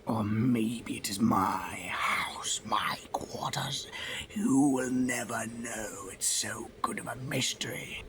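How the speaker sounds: slow whispered mystery voice; spooky mock whisper. The toy taunts about the book, slow spooky whisper. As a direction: tease like a cheesy mystery host.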